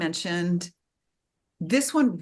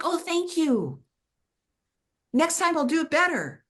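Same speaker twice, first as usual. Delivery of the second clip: high-pitched, grateful acceptance; grateful reply; 10 semitones higher than usual. Acted. Student thanking the inner teacher, high and grateful. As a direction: take the correction gladly, voice lifted high.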